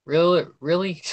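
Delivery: incredulous. Retold or imitated. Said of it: "reading to myself".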